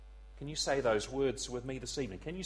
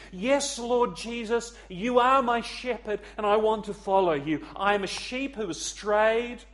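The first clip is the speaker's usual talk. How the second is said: raised, emphatic cry to Jesus; fervent prayer; 6 semitones higher, 3 dB louder than usual. Acted. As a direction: pray it out with conviction, high and strong. Models a believer's prayer response.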